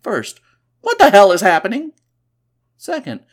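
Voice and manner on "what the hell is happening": loud, high acted alarm; bewildered panic. Imagined pilot's thought while being shaken, acted loud panic. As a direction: blurt it in startled confusion. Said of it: "three things are going through his mind".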